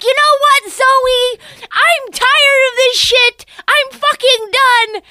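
high, loud, slow blowup; husband snapping at dinner; hammed-up shouted meltdown; mock furious husband. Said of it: "He's like".

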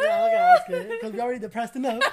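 laughing self-quote. Retold or imitated. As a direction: blurt it out through laughter. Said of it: "I was like".

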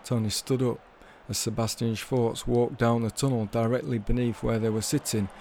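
ordinary delivery

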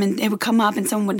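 ordinary talk, nothing marked

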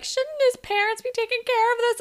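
mock whiny girl voice; whiny Allie. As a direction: whine it with entitlement. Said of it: "And Allie's like".

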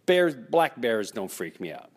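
usual speaking voice

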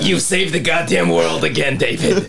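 a hint of a camp New York assistant; lightly voiced gushing sidekick.

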